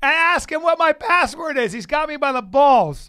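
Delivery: high, loud outburst; exasperated shouting. Real, not acted. Podcaster locked out of his own password, exasperated shout. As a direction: rant at full volume in comic frustration.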